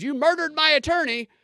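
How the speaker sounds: hammed-up courtroom outburst, voice jumping up; mock scream. Mock news story, defendant yells at the judge, hammed-up scream. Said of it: "the defendant screamed".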